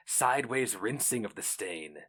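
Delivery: ordinary delivery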